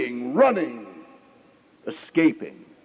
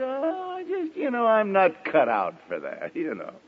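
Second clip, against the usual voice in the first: pious excuse; 4 dB quieter than usual. Retold or imitated. Churchgoer turns down the minister's call, mock pious and soft. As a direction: play the falsely humble churchgoer. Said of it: "Do you piously say".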